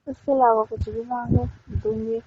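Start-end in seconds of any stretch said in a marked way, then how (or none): none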